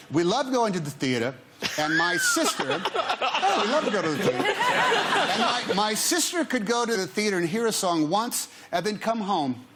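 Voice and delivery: the Queen. Retold or imitated. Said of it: "she said".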